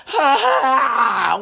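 imitating a dramatic yawn; loud mock yawn cry. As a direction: belt out a fake yawning wail. Imitating daughter's yawn noise.

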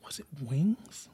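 whispered, slow, to himself; whispering. Real, not acted. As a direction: mutter it under your breath, slowly. Trying to remember, to himself.

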